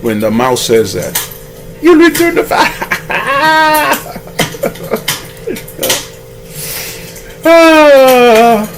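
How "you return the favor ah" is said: very high squeal dissolving into hammed-up laughter; lion's mocking laughter. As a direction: play a lion laughing helplessly at the very idea. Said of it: "lion laughs even harder".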